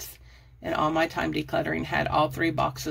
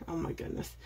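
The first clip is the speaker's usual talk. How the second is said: breathy aside; 11 dB quieter than usual. Real, not acted. Embarrassed at her rambling.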